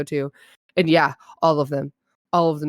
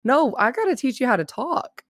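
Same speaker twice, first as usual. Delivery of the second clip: lightly voiced teacher, pitched up; mock-firm schoolteacher, higher; correcting teacher; 7 semitones higher than usual. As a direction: imitate a teacher putting pupils right. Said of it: "And the teacher's like".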